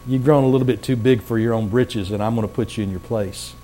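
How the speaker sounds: low, softened voice of reprimand; quiet rebuke. Retold or imitated. God telling a proud nation off, quiet low rebuke. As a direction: put them in their place softly, voice dropping.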